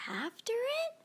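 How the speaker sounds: high, soft whisper; whispered question. A narrator's reading. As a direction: breathe it secretly. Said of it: "we all whispered".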